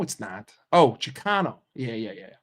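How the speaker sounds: usual speaking voice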